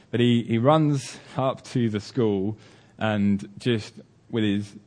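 normal talking voice

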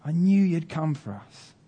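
high mock shout at contained volume; high, spaced-out calls of a trusting son; boy shouting. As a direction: imitate a boy calling out to his dad. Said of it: "just shouts".

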